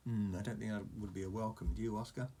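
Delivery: plain reading voice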